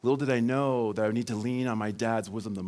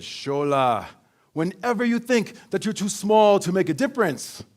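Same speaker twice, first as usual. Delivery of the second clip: higher, louder, slow father's proverb; father imparting wisdom to his bullied son; wise dad voice; 4 semitones higher, 7 dB louder, slower than usual. Retold or imitated. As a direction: hand down the riddle like a grand African patriarch. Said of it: "in a voice that I can do pretty well by now".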